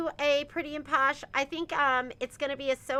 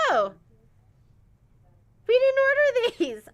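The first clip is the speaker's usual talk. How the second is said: imitating shock, much higher and louder; mock surprise; 11 semitones higher, 8 dB louder, much faster than usual. Shop owners opening a wrong shipment, mock surprise. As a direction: act baffled at the box.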